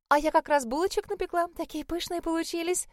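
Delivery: high sugary flirty voice; cartoon princess. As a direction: coo it sweetly at him. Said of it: "прощебетала она, игриво улыбаясь".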